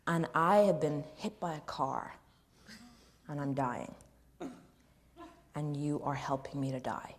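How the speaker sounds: measured, deliberate delivery; lightly voiced film actor. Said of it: "do this impersonation".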